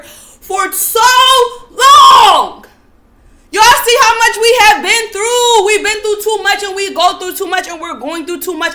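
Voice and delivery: yelling at her people. Real, not acted. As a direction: shout it out in anger. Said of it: "me yelling at y'all".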